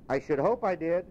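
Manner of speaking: a lad amazed anyone would ask; young boy. Acted. Boy telling Scrooge it's Christmas, puzzled. Boy's voice.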